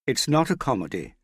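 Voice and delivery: loud outburst. A narrator's reading. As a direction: say it out loud, cutting in. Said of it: "she said loudly".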